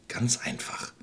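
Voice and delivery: soft tempting whisper; sly whisper. Acted. Witch lures the children, sly whisper.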